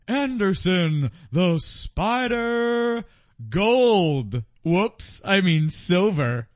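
booming ring announcer, words stretched long; hammed-up fight intro, high and loud; Bruce Buffer. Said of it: "Bruce Buffer goes".

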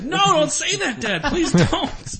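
laughing plea. Retold or imitated. Son begging his dad to stop, laughing. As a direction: beg Dad to stop while cracking up.

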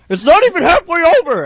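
high-pitched mock yell; protesting moviegoer. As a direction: holler it like an annoyed audience member. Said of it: "another guy's like".

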